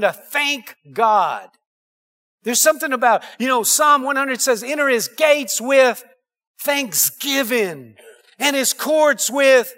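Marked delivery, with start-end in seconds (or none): 4.6-9.7 slow recitation. Shown as a demonstration. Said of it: "slow quoted psalm".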